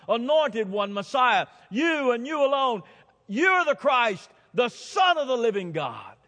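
high, loud full-force preaching; emphatic confession. Retold or imitated. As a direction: proclaim it at full force, hammering each phrase. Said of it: "It's emphatic".

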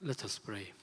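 usual speaking voice

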